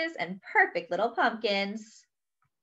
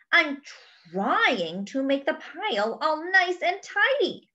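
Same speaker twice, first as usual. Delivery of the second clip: higher-pitched, huffy storybook protest; 7 semitones higher than usual. Acted. Hippo to Duck about his ruined leaf pile, huffy. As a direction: say it with an exasperated huff. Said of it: "Hippo said with a huff".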